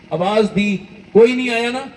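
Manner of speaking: fervent sermon outburst; climactic shout. Real, not acted. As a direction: cry it out to the crowd. Preacher's climactic call.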